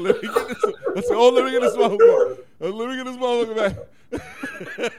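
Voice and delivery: high, loud frantic yelling at the ball. Retold or imitated. Scrambling for the ball, a loud frantic yell. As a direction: yell in a panic to grab it back.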